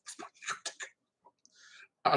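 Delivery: drawn-out, very soft whisper; slow whisper. Retold or imitated. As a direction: breathe it out slowly, barely there. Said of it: "and he's like".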